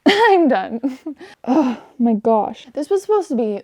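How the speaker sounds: loud, high, laughing exasperation; exasperated outburst. Real, not acted. Reviewer quitting her rant on a book, loudly exasperated. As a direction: burst out, fed up, stretching the last words.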